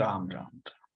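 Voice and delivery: soft, low devotional chant of Ram; prayerful murmur. Shown as a demonstration. Teacher demonstrates praying tone, soft devotional murmur. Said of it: "tone से पता चला कि आप पूजा कर रहे हैं".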